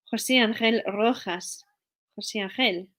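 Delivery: Jose Angel Rojas slowed, with a Spanish jota on Jose and Angel and a rolled Rojas; slow and careful, give the name its Spanish sounds; Spanish accent. Pretend. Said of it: "I have to limber up first".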